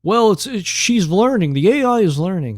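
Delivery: high mocking whine; mocking excuse. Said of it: "And they're like".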